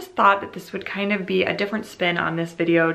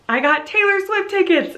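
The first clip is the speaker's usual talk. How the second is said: much higher and louder, thrilled; all caps excitement; 13 semitones higher, 4 dB louder than usual. Shown as a demonstration. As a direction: read it like it's shouting in capitals. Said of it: "in all caps".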